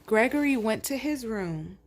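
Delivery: plain reading voice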